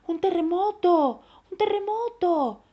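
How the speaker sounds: high, loud cry of alarm; children yelling in fright; alarmed shouting. A narrator's reading. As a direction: shout a panicked warning. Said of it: "gritaban los tres chicos valientes".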